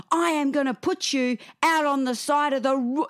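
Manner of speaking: high-pitched imitation of a cross little boy; retold child's shouted threat; mock indignant kid declaration; shouting child. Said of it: "he's in there shouting things".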